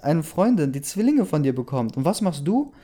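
high-pitched, agitated rant; furious accusation. A narrator's reading. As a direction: fire off indignant accusations at a cheating partner. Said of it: "Ich war so wütend".

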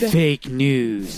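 lightly put-on politician voice; a hint of a presidential impression; Donald Trump. Shown as a demonstration. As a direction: drop it like a president's catchphrase. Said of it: "Donald Trump would be like".

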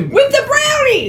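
high, slowed outraged cry; a high drawn-out cry of disbelief. Real, not acted. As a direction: stretch it out in disbelief. Outraged exclamation.

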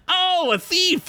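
high, slow nerdy bard. Acted. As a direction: play the lute nerd slowly. Lute nerd character speaks.

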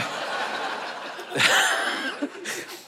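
real airy burst of laughter at his own joke; breathy laugh. Real, not acted. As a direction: let a soft unvoiced laugh break out.

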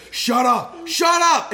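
high loud mock rage yell. Imagining Corey raging at his kid, mock yell. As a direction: yell it like losing your temper. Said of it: "be like".

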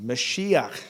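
"Mashiach" with a throaty final ch; Hebrew Mashiach. Shown as a demonstration. Preacher teaching the Hebrew word, throaty guttural ch. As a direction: say "Mashiach", ma-shi-ach, guttural ch. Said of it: "that Hebrew word is Mashiach".

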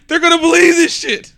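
high, loud laughing quote; laughing in disbelief. Acted. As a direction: crack up while playing the gleeful schemer. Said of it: "can't even say it without laughing".

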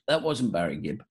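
usual speaking voice

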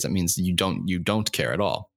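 ordinary talk

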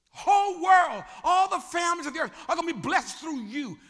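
loud, high, soaring; booming promise. Acted. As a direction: lift it to the heavens. God's great promise.

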